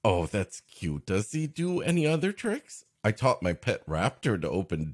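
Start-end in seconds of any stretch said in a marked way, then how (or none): none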